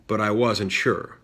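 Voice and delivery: ordinary delivery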